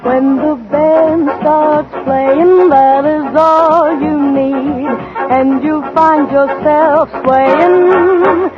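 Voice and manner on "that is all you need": normal talking voice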